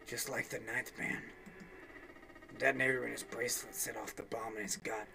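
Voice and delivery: a touch of strain, kept low and tight; understated strained murmur. Acted. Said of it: "Seven's voice was low and strained".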